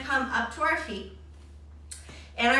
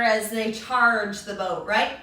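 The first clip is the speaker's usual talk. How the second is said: ordinary talk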